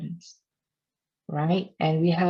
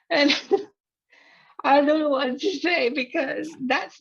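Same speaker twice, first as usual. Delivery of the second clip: talking through a laugh, voice pitched up; 8 semitones higher than usual. Real, not acted. To viewers about angry followers, laughing. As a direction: laugh helplessly while explaining to viewers.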